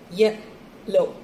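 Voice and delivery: broken into two separate syllables. Shown as a demonstration. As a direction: say YE and LO apart with a clear gap. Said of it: "So two syllables for this word".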